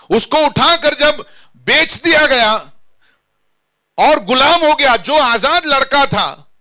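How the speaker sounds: high, impassioned pulpit storytelling; full-force preaching. Acted. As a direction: drive each phrase home to the congregation.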